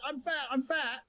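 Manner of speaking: high mocking squeal, stammering; hammed-up shrieking feminist. Mocking a feminist who objects to five wives, high squeal. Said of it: "and the feminist goes".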